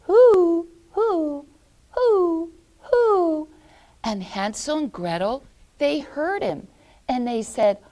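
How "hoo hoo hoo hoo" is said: high, loud hooting owl. Acted. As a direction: hoot like an owl up a tree. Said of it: "he kept going".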